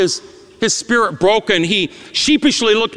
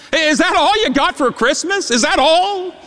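taunting; 6 semitones higher than usual. Retold or imitated. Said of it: "the assault on my ears".

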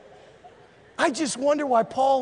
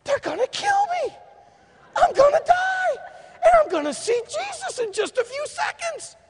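much higher, loud preaching shout; shouting joyfully in the face of death; ecstatic shout; 5 dB louder than usual. Retold or imitated. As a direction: cry it out like it's good news. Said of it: "he says".

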